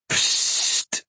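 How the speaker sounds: voiceless skunk-spray hiss; short hiss like a spray going off; imitated spray hiss. A narrator's reading. Skunk sprays the narrator, imitated hiss.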